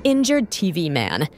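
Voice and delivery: low, sorry reaction; sad dismay. Real, not acted. Fan seeing injured T V Man, sad dismay. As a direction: let the voice sink in dismay.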